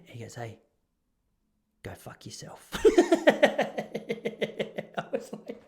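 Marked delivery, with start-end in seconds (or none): 0.3-2.7 a retold whisper, far softer; imitating an old man's hushed jab; whispered insult. Old resident insults him quietly. 2.7-5.6 helpless real laugh; real laughter. Real, not acted. Laughing at the insult.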